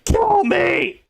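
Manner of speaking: high-pitched imitation of screaming; mock scream. Streamer imitating the woman in the video, mock scream. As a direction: screech it in mock agony. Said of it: "where she's screaming".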